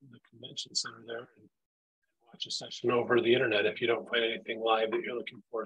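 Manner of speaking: usual speaking voice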